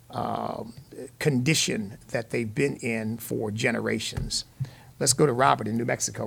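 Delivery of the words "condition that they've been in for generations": ordinary delivery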